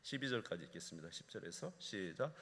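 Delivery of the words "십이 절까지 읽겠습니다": normal talking voice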